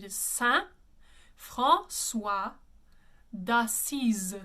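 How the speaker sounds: French names said with a French Z in d'Assise; French pronunciation. Shown as a demonstration. Opera coach demonstrating the saint's name in French. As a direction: say François d'Assise the French way, Z sound in d'Assise. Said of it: "the reason why we say d'Assise".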